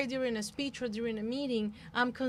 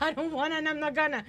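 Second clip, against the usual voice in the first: tag defiant refusal; 4 semitones higher, 5 dB louder, much faster than usual. Retold or imitated. Acts out son's refreshing refusal.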